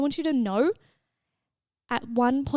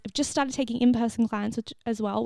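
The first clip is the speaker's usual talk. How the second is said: ordinary delivery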